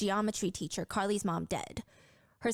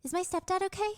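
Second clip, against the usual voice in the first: put-on higher girl's voice, polite and worried; worried teen girl; 10 semitones higher than usual. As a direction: ask it as the small polite girl. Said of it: "she asks".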